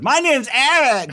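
loud, high, drawn-out mock yell; Eric. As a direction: blurt it out as a slow, hammed-up caricature. Mocking Eric Trump mid-sentence.

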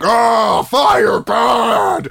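loud, high monster shout; Frankenstein monster. Retold or imitated. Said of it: "he's like".

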